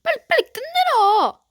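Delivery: high, loud, frantic pleading; desperate shout. Real, not acted. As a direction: beg the game to end. Player begs match to end.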